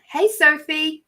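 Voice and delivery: lightly voiced inner greeting; calling inwardly. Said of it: "in my se-, head, said".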